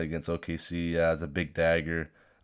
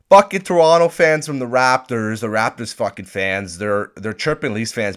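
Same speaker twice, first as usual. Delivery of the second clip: loud, heated rant; 5 semitones higher, 12 dB louder than usual. Real, not acted. Vlogger ranting to viewers about Raptors fans, loud heated rant. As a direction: bang out the complaint.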